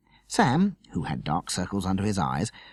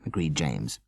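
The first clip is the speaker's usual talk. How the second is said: plain reading voice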